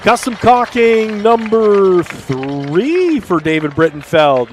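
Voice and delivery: high, slow, stretched-out announcement; excited announcer. Real, not acted. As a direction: draw the words out in a big ringing call. Announcer naming score after touchdown.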